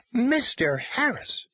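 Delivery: indignant outburst. Acted. Bledsoe jumps up furious.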